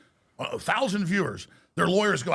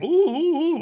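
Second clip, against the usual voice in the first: higher, louder sarcastic oohs; mock fear; 10 semitones higher, 6 dB louder, slower than usual. Mocking the enemies' threats, sarcastic mock fright. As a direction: pretend to be scared, tauntingly.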